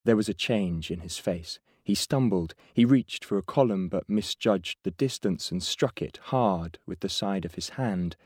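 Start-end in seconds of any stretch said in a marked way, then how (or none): none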